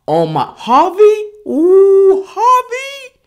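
high, loud excited squeal with a laugh; gushing squeal. Real, not acted. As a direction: shriek her name up high in delight. Gushing over Harvey.

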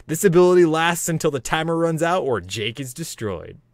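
ordinary talk

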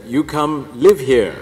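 slow, imitated Croatian accent. Priest orders the visitor to move in, in a Croatian accent. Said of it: "I have to do the accent".

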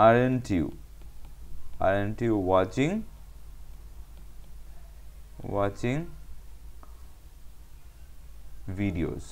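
slow, broken up with long pauses; piecemeal dictation. Shown as a demonstration. Teacher writing out the negative question, saying it haltingly piece by piece. Builds sentence slowly while writing it.

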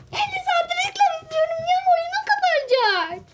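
high frantic complaint; frantic whine. Real, not acted. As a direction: rush it out in a squeaky panic. Frantic over long ad.

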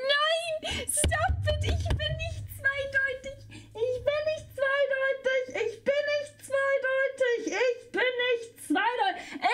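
high, slow whining denial; whiny denial. Acted. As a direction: wail the denial childishly. Protests chat's accusation. (hohes, gedehntes Jammern)

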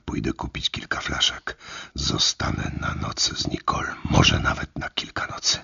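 whispered inner thought; thought aloud. Acted. Narrator thinking about Nicole, a quiet whispered thought. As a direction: whisper it to yourself, resolved. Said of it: "pomyślałem".

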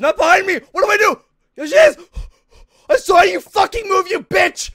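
loud high furious yelling; trash-talk the monster at full volume; angry shouting. Real, not acted. Shouting at monster after scare.

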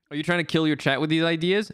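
ordinary talk, nothing marked

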